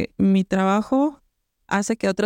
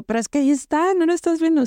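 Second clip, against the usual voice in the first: rising higher in disbelief; exasperated; 8 semitones higher than usual. Retold or imitated. Retelling her argument with the seamstress, exasperated and higher. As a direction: push back, unable to believe she can't see it.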